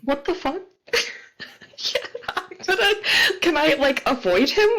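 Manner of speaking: high, fast, laughing panic; panicked laughing. Real, not acted. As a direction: blurt it out flustered. Player panics at club attack.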